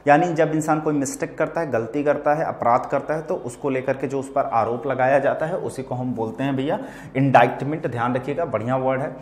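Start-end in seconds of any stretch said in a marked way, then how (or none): none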